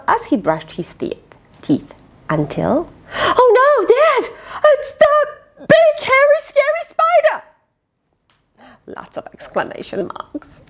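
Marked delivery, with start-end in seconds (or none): 3.1-7.4 panicked screaming. Acted. Boy in the bathroom screams to Dad about the spider, high panicked cry. 8.9-10.6 hushed whisper; whispered aside. Real, not acted. Narrator whispers an aside to the listeners, hushed.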